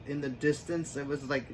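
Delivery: ordinary talk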